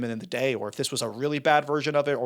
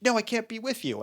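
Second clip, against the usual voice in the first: higher, lightly acted refusal; a hint of a torn heroine pushing him away; mock protest; 8 semitones higher than usual. Retelling a typical film heroine refusing, higher mock protest. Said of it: "she's like".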